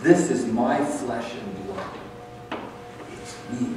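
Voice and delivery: slow, soft, fading to a whisper; hushed reflection. Real, not acted. Pastor quietly reflects before the congregation eats, hushed. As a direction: let it sink in quietly.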